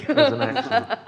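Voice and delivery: breaking into a high laugh mid-line; can't keep a straight face describing it; giggly, amused aside. Real, not acted. Player laughs at own action.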